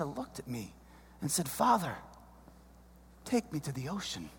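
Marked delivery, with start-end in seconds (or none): 1.5-4.3 put-on soft, low kid voice asking dad; a small boy quietly pleading; little son's voice. Son speaks to dad.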